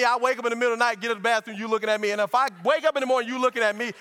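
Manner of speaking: ordinary talk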